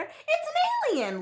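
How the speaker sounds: high, eager little-bear call; thrilled, not scared; excited whisper. A narrator's reading. Little bear spots Goldilocks, excited high voice. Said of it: "whispered excitedly".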